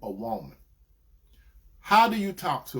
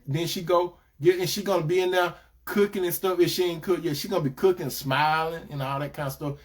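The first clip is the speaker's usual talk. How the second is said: ordinary delivery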